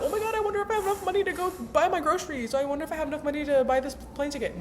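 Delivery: high, fast, mock fretting; mock worried millionaire. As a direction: ham up a panicked money worrier. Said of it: "a millionaire sits around being like".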